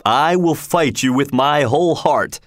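ordinary delivery

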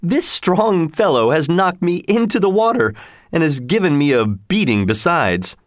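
ordinary delivery

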